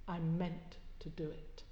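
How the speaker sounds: a hushed, deliberate admission; slow and quiet. Acted. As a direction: let it fall quietly and slowly. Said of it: "then said slowly".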